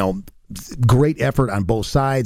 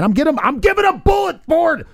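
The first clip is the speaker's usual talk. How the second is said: high, bursting motivational shout; hyped-up shout; 13 semitones higher than usual. Real, not acted. Pundit trying to motivate the players, high hyped shout. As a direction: yell it like firing up the locker room. Hype talk to motivate the team.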